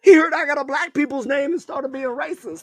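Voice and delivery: high, squealed comic complaint; mock indignation. Riffing on his name to a laughing co-host, mock indignant. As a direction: push it way up high in joking protest.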